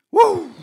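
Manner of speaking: high-pitched "woo" of pretend pain; mock pained woo. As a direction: let out a high, playful wince.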